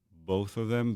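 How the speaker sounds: ordinary talk, nothing marked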